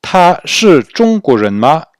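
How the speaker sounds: emphatic teacher reading; slow model question. Shown as a demonstration. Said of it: "On aura la forme en ma".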